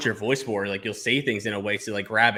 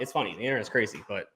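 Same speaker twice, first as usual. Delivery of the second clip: ordinary talk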